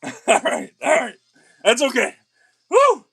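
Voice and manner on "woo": very high, loud yelling cheer; ecstatic whoop. Real, not acted. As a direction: scream out the joy. Celebrating with friends.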